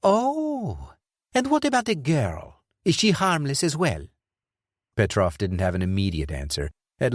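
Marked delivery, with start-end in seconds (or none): 0.0-4.1 much higher, mocking; taunting villain; sarcastic Dufour. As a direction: sneer the question.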